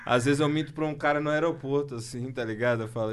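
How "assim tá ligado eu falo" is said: ordinary talk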